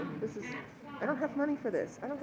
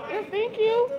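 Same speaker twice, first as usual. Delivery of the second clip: calling out; 10 dB louder, slower than usual. Real, not acted. Calling thanks to passersby.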